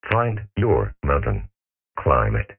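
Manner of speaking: normal talking voice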